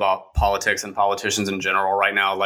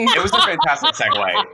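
laughing; 9 semitones higher, 4 dB louder than usual. Real, not acted. Laughing praise.

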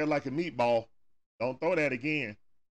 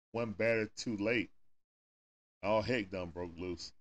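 ordinary delivery

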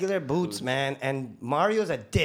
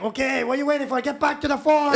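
higher, louder, building shout; mock crew yell; 9 semitones higher, 6 dB louder than usual. Crew yelling at rookie to get back to work, loud mock. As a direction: order them back to work. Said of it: "they're like".

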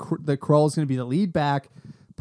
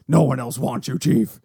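cold belittling sneer; low put-on tough-guy voice; Nick Nolte voice; 3 semitones lower, 4 dB louder than usual. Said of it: "I went to the Nick Nolte voice again".

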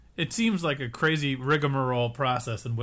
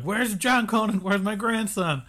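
mock yelling; 6 semitones higher than usual. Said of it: "she's yelling at all the people".

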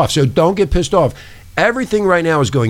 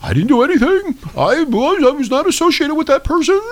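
high whiny voice pleading innocence; mock denial; 14 semitones higher than usual. Host mocks hiding celebrities in a high whiny denial. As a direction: squeak out a guilty denial. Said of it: "They're hiding under bushes".

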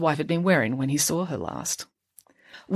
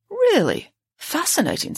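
mock amazement; 12 semitones higher than usual. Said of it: "said Doctor Barnes".

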